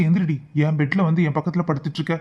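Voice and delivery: loud, sharp order to get out; angry order. Acted. Man yelling at cousin to leave his bed, loud and angry. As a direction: drive her out of the bed.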